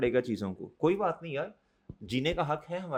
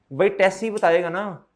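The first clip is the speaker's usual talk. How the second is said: louder and higher, driving the point home; emphatic exclamation; 4 semitones higher, 9 dB louder than usual. Real, not acted. Teacher insisting to students that only tests show progress, loud and emphatic. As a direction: land it hard, almost shouting.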